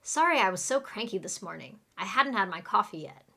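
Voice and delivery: quick, linked native pace; fast shadowing read; really fast. Shown as a demonstration. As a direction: run the words together fast. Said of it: "we'll say it really fast".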